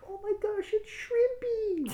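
very high, stretched-out crowd squeal; excited fans. Acted. Game master voices fans spotting a new fighter, high excited cry. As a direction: cry it out high, dragging the name. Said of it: "you can hear the fans up there like".

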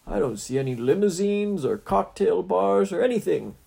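high, loud spoiled celebrity whine; whiny hero voice. Acted. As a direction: whine like a bored star. Vain TV hero complaining.

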